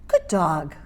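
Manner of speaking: low, soothing praise; approving dog-trainer praise; praising tone. Shown as a demonstration. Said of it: "Did you hear the change in my voice?".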